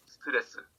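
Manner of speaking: "스트레스" softly as seu-teu-re-seu; all syllables voiced. Shown as a demonstration. Teacher showing they're all voiced, soft "스트레스" as seu-teu-re-seu. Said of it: "They're all voiced".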